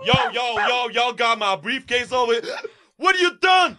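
shrill, loud street voice; high panicked gangster. Acted. Panicked gangster character shouting.

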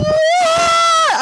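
a piercing scream of horror; anguished scream. Retold or imitated. Hearing her son is missing, anguished scream. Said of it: "And I went".

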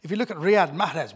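ordinary delivery

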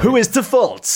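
loud, high true-crime question; dramatic statement. Acted. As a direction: throw out the big question like a crime-show host. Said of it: "can I do a statement or something".

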